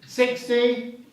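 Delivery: brisk auction calling, counting up bids; auctioneer. Real, not acted. Auction bidding calls.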